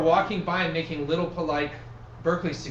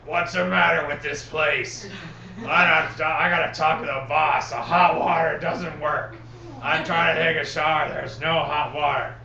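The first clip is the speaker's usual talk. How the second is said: lightly voiced grumpy old man; 5 dB louder than usual. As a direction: grumble it like a cranky old man. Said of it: "this grumpy old man voice".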